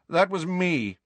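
Malfoy voice. Acted.

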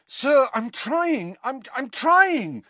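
high, drawn-out pleading; desperate. A narrator's reading. Harry fails to bring water, desperately pleading. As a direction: stammer it out desperately. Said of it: "said Harry desperately".

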